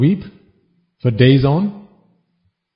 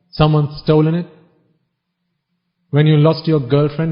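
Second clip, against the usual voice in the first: ordinary talk, nothing marked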